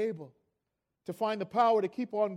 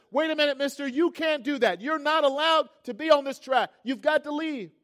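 higher, louder acted security guard; imitated stern objection, pitched up; officious challenge; 6 semitones higher, 7 dB louder than usual. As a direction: order him off as a guard would. Acts guard confronting father on track.

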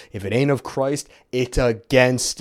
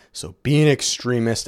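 ordinary talk

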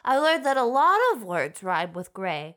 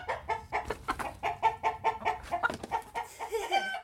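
chicken cluck sound; 10 semitones higher, 8 dB quieter than usual. Pretend.

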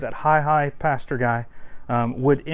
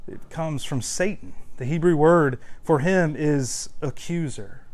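normal talking voice

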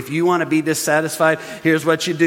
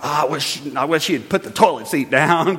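complaining spouse; 5 semitones lower than usual. Retold or imitated. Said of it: "complain about 'em".

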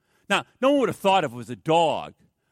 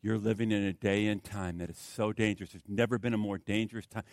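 soft pleading; 8 semitones lower, 9 dB quieter than usual. Real, not acted.

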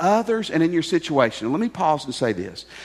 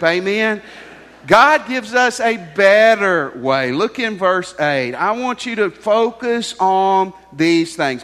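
preaching; 2 semitones higher, 6 dB louder, slower than usual. Acted. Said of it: "preaching at full force".

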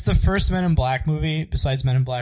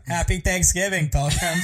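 slow, higher bad American cowboy accent; drawn-out mock American accent, pitched up; imitated bad accent; 4 semitones higher, slower than usual. Showing friends what it sounded like, bad American accent. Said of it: "What did it sound like?".

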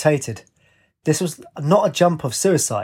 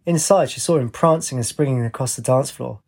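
usual speaking voice

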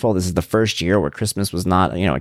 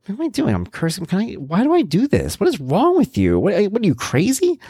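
much higher, incredulous at himself; startled self-reproach; 12 semitones higher than usual. Retold or imitated. Catching himself cursing at himself, much higher and incredulous. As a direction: catch yourself mid-rant in disbelief. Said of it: "I'm like".

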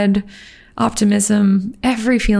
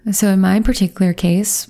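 normal talking voice

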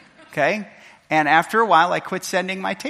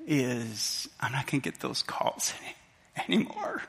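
soft, halting, voice breaking at the end; real emotional choking up; 2 semitones lower, 8 dB quieter than usual. Real, not acted. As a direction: fight back tears and falter. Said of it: "sorry, I'm going emotional here".

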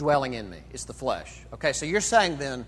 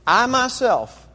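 "I myself" slow, high and loud; emphatic; 9 semitones higher, 8 dB louder, slower than usual. Shown as a demonstration. Preacher rereading Paul's verse with its emphasis, "I myself" stressed. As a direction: lean hard on "I myself", stretched and raised.

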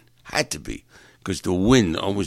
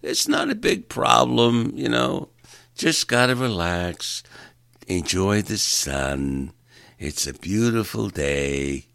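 mellow locals; 2 semitones higher, slower than usual. Retold or imitated. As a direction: float it like a carefree local. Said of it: "They're all like".

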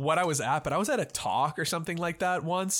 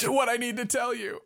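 high, squeezed character voice; a hint of choking, pitched up; strained victim; 7 semitones higher than usual. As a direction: press on urgently while being strangled. Choked man argues with his attacker.

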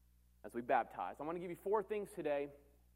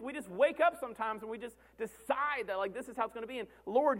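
usual speaking voice

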